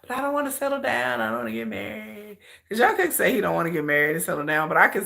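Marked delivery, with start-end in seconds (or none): 0.0-2.4 higher, careless brush-off; lightly voiced, dismiss her with a shrug. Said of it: "And he be like".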